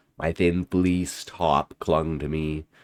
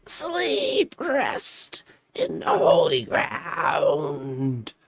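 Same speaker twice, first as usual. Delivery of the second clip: high, slow wailing ghost voice; creature plea; 12 semitones higher, much slower than usual. Acted. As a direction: draw it out like a mournful ghost. Creature demanding burial.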